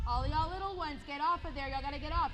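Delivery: high-pitched call across the pool. Real, not acted. Calling kids off the bulkhead.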